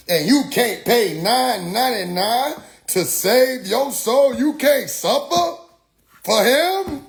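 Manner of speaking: high, drawn-out mock preacher challenge; imitated street preacher. Preacher demanding money for a saved soul, high mock indignation. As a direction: press the listener with rising, preachy indignation.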